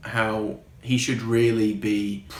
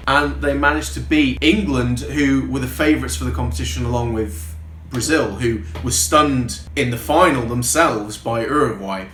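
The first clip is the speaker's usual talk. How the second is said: ordinary talk, nothing marked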